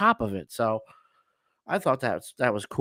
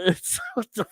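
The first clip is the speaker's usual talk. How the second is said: laughing; 5 semitones higher than usual. Real, not acted. Laughing while telling story.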